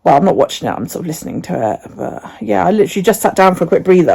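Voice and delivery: ordinary delivery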